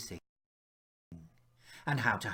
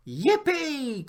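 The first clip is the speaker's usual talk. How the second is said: much higher, loud squeal of joy; excited cry; 13 semitones higher, 5 dB louder than usual. Acted. Horace crying out when dad agrees to play. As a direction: leap up with delight. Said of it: "cried Horace".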